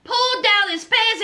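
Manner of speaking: ordinary talk, nothing marked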